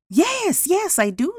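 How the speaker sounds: high, eager yes-yes; eager assurance. A narrator's reading. Jose confirming his horse offer to the judge, eagerly. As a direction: reassure insistently, pitch jumping up.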